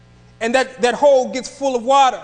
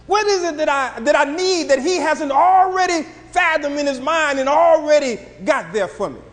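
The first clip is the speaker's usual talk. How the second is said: raised, higher-pitched preaching cry; preaching shout; 5 semitones higher, 3 dB louder than usual. Acted. Preacher challenging the congregation with a rising shout. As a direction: build and shout the question.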